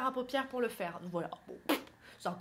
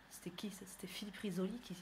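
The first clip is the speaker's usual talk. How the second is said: whispered aside, much softer; hushed self-question; 3 semitones lower, 12 dB quieter than usual. Real, not acted. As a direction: breathe it to yourself. Aside to herself mid-jingle.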